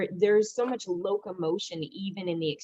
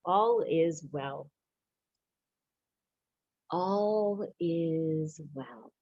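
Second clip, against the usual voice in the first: slow soothing repeated mantra; calm affirmation; much slower than usual. Acted. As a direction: let each one slow and settle. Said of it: "I use the affirmation".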